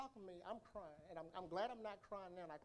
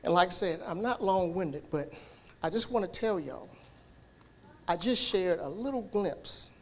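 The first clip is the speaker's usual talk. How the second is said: loud, driving sermon voice, louder; preaching at full force; 20 dB louder than usual. Acted. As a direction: preach it out to the church, full volume.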